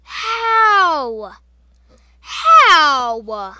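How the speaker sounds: loud disbelieving yell; exasperated shout. Real, not acted. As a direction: cry out in disbelief. Just died in game.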